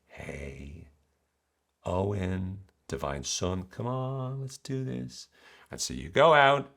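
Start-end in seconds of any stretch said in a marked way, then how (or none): 0.1-5.3 hushed, enticing mother voice, rising on the invitation; coaxing mother. Acted. Mother tempting her son toward adventure, softly coaxing. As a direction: softly coax a son to come along.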